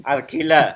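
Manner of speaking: "alquilar" said "arkila", r for l, last r dropped; Tagalog pronunciation. Shown as a demonstration. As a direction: Filipino "alquilar" as "ar-ki-la". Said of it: "retained it ... but shortened it".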